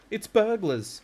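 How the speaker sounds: high, loud, urgent alarm; alarmed hiss. A narrator's reading. Mother warns the family of burglars, urgent alarm. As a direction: raise a panicky alarm. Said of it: "hissed the mother".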